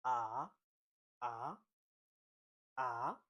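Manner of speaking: Mandarin second tone on AH, sliding upward. Shown as a demonstration. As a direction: let AH climb like a question. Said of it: "You rise up".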